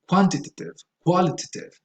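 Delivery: British-style quantitative, qualitative with reduced -tuhtive ending; British English weak third syllable, QUA punched; British pronunciation. Shown as a demonstration. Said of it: "in British English you say".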